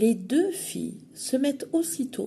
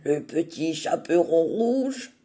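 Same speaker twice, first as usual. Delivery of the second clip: lightly disguised wolf voice; wolf disguising his voice; 3 semitones lower, 4 dB louder than usual. Acted. The wolf at the door disguises his voice for Grandmother. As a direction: fake being the girl. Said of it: "en déguisant sa voix".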